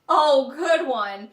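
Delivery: loud, drawn-out mock approval; sarcastic praise. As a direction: stretch it out with heavy sarcasm. Sarcastic reply to the joke.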